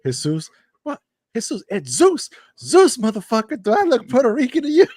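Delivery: indignant, high-pitched, loud outburst; building from polite thanks to loud outrage; movie quote. Retold or imitated. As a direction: act out an outraged movie rant. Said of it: "when he gets in the car, he goes".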